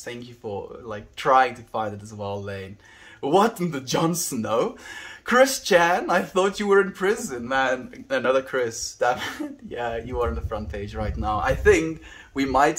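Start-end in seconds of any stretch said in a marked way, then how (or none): 3.2-9.6 high, loud mock shock; surprised. Real, not acted. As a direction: act startled and exasperated. Mock outrage at chat.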